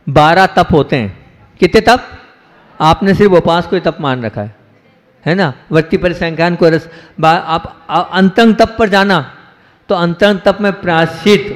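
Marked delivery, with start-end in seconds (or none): none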